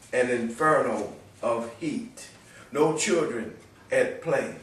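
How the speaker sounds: emphatic, measured poetry reading; solemn warning in verse, punching key words; prophetic poem recitation. Acted. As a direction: proclaim it in short, weighted phrases.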